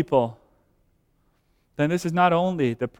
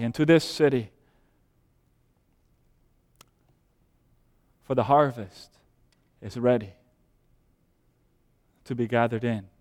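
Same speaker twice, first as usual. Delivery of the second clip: softer, lower, slowed and pleading; hushed pastoral appeal; 5 semitones lower, 5 dB quieter than usual. Real, not acted. Pastor pleading softly with his beloved friends. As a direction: draw the congregation in close, gently.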